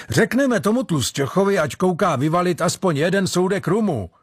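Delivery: high-pitched rough-and-ready sailor relishing rum; gleeful sailor. A narrator's reading. Sailor behind the door looking forward to rum, gleeful. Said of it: "těšil se nějaký hlas za dveřmi".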